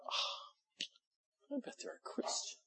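hushed, high whisper to himself; breathy aside of his own naive thought; whispered inner thought. Retold or imitated. Preacher whispering his naive thought to himself.

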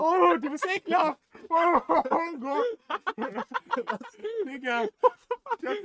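high, loud, slow laughing disgust; disgusted laughing shout. Real, not acted. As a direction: shriek with laughter at something gross. Gum coughed back up.

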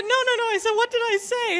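high, insistent reenacted denial; retold baffled protest. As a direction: protest in bewilderment, pitched right up.

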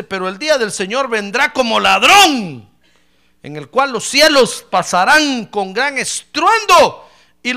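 loud, slow preaching delivery; scripture read with force. Real, not acted. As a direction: proclaim the verse to the church.

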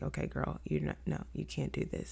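soft, dropped-down talk to yourself; quiet self-correction. Retold or imitated. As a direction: pull right back and level with yourself. Retelling quiet talk to herself.

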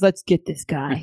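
low, slow, eerily calm voice; creepy. Retold or imitated. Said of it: "very creepily says".

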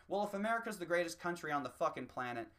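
ordinary talk

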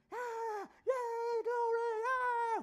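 high, loud praise shouts; joyful shouting. Acted. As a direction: cry out glory with joy.

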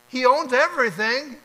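emphatic. Real, not acted. Preacher hammers the point home.

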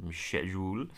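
soft mock-British "Schedule"; hushed British imitation; British accent. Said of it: "they say that last part again".

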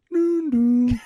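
loud, high two-note chime; mimicked train chime; imitated door chime. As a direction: sing out the closing-doors signal.

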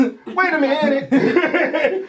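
slow drawn-out mock [name]; a playful [name] impression. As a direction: drag out the words like [name]. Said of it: "My [name] voice".

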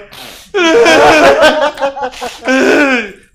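a big, loud laugh bursting out; loud burst of laughter. Real, not acted. Laughing loudly at himself to his friends. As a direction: crack up loudly at your own joke. Laughing about crying at quinceañera.

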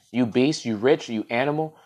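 ordinary delivery

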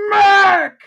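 very high loud yell; shout. Acted. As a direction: belt the name out. Wrestling announcer shout.

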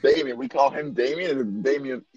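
ordinary talk

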